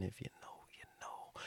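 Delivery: conspiratorial whisper; whispered aside. Real, not acted. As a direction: lean in and whisper it. Aside after a joke.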